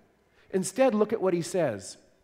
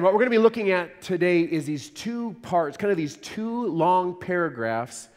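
ordinary talk, nothing marked

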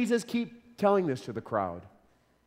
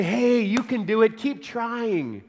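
a hint of a cheery cheerleader; lightly mock an upbeat coach; mock pep talk; 4 semitones higher, slower than usual. Preacher mocking the pep talk Jesus doesn't give. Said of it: "some encouraging pep talk".

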